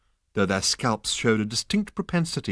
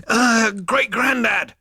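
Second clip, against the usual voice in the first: calling out; 13 semitones higher, 7 dB louder, slower than usual. A narrator's reading. Zaphod calling out.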